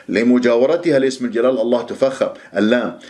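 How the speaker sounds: ordinary talk, nothing marked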